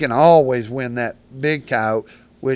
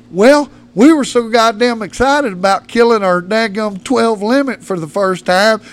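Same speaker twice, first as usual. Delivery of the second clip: loud, high aggrieved rant easing off; worked-up mock outburst, gradually settling; exasperated confession; 7 semitones higher, 7 dB louder than usual. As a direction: retell a buddy's agonized explanation. Retold friend's aggrieved confession.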